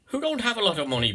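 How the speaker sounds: high, lilting Irish little-people voice; Irish accent. Pretend. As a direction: play a wee Irish leprechaun. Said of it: "forgive the Irish".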